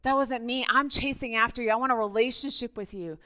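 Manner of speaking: ordinary talk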